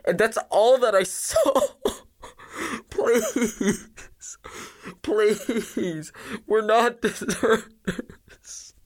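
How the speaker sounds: high, slow tearful wail; terrified survivor breaking down in tears; sobbing. Acted. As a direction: sob out the account, begging. Blinded survivor sobbing out his story.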